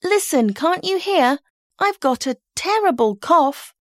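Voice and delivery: put-on twelve-year-old faking illness; poor sick girl. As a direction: pity me, mum. May pleads sick to mum.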